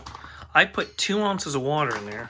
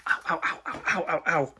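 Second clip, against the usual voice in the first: quick breathy ows of pain; 3 semitones higher than usual. Real, not acted. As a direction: yelp at the burn. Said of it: "Man, that hurts".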